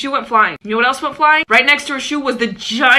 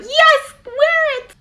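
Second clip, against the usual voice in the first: mom's high agreeable voice; 3 dB louder, much slower than usual. Retold or imitated. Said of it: "she was probably like".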